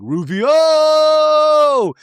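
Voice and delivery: high, loud hammed-up yell of a name; mock battle cry. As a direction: belt it out like a film battle cry. A Hook reference as [name] storms out.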